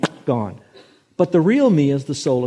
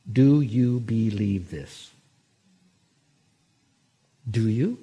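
Jesus asking; 2 semitones lower, slower than usual. Acted. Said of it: "let Jesus ask you this question".

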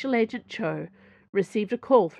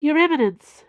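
higher, louder breathless call; gasped; 8 semitones higher, 9 dB louder than usual. A narrator's reading. Cho bursts in on the cardinal, gasping out news. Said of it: "she gasped".

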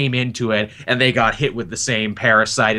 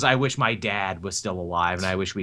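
mild wistful Hal; 5 semitones lower, 7 dB quieter than usual. Retold or imitated. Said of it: "And Hal's, of course, is".

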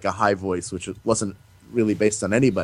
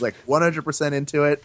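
ordinary talk, nothing marked

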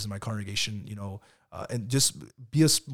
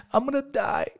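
put-on much higher small child voice; imitating his young son; 4 dB louder than usual. Father voicing his son answering about dying, high child voice. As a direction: squeak it out like a little kid. Son's voice in retold dialogue.

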